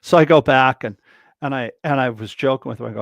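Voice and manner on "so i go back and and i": normal talking voice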